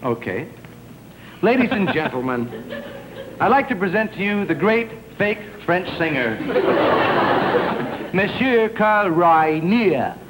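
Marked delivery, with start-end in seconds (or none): 1.4-10.2 grand showbiz introduction, drawn out; announcer intro. Acted. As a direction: announce the star with a flourish.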